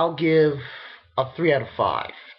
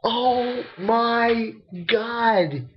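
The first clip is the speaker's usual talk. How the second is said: stunned; 7 semitones higher, much slower than usual. Retold or imitated. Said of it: "I'm like".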